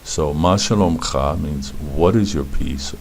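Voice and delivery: usual speaking voice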